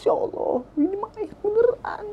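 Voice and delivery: breathy, high, tearful whisper; choked near-sob whisper at a corpse; retold crying whisper. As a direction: whisper it through tears, horrified. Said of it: "gua nangis".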